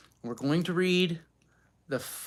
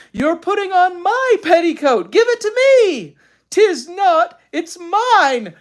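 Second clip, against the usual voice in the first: put-on high, loud squabbling kid; quarreling children; 12 dB louder than usual. A narrator's reading. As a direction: bicker over the sock.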